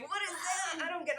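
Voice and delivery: high, loud imitation of baffled people; playfully hammed-up incomprehension; mock bafflement. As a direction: exaggerate the baffled question, half laughing. Voicing people baffled by Puerto Rico.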